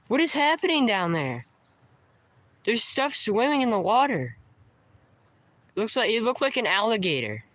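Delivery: real startled urgency; alarmed commentary. Real, not acted. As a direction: narrate it fast as it moves. Watching something swim nearby.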